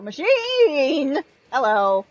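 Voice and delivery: slow, loud gamer exclamation; excited shout. Real, not acted. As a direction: stretch it out, thrilled. Spots a game item, excited.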